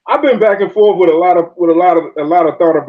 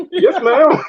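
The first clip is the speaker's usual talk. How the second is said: high, laughing, sheepish yes ma'am; meek yes ma'am; 9 semitones higher than usual. Retold or imitated. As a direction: give in like a scolded kid, laughing through it. Meekly answering scolding old lady.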